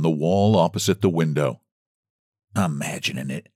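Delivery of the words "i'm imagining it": low, soft mutter to oneself. A narrator's reading. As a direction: mutter it under your breath. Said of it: "muttered she".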